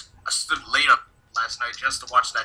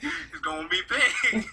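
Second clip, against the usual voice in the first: slow, softer, higher amused echo; laughing; 10 semitones higher, 6 dB quieter, slower than usual. Real, not acted. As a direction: laugh through the words.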